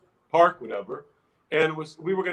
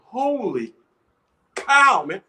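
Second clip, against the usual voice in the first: louder, higher holy cow man; awestruck exclamation; 6 semitones higher, 8 dB louder, much slower than usual. Retold or imitated. Recalling to interviewer the roaring crowd backstage, awestruck and loud. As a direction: let it burst out in amazement.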